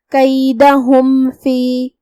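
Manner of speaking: کیدہم فی slowly, with the م closed cleanly and no humming; کیدہم فی, clear meem without nasalization; clear meem without nasal. Shown as a demonstration. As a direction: keep the م of کیدہم crisp before فی. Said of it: "ہم میم کو ظاہر کریں گے اور بغیر غنہ".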